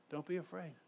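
soft, hushed reassurance; gentle angel. Retold or imitated. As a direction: say it softly, like an angel calming a frightened shepherd. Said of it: "the very first thing the angel say".

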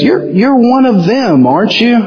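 accusing bystander. Retold or imitated. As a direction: corner him with the question. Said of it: "someone says".

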